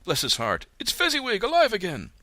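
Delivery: put-on very high, delighted old man's cry; great excitement. A narrator's reading. Scrooge spotting old Fezziwig, crying out with joy. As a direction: burst out with joy. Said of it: "Scrooge cried in great excitement".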